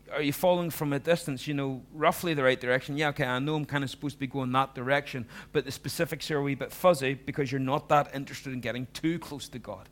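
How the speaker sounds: loud, driving sermon delivery; fervent preaching. Acted. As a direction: preach it hard to the congregation. Preaching at full force.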